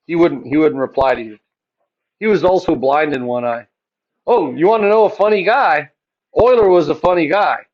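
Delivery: usual speaking voice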